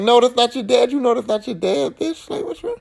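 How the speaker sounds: high, incredulous scold; hammed-up disbelief; mock scolding. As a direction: talk sense into her.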